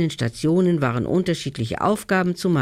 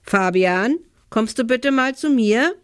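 mildly raised, higher calling voice; 8 semitones higher, 3 dB louder than usual. A narrator's reading. Mother summons her son from another room, calling out. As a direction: call across to the next room, pitch climbing. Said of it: "rief sie mit lauter Stimme".

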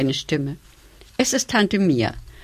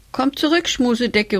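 calling out; 6 semitones higher, 3 dB louder than usual. A narrator's reading. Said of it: "ruft Pico".

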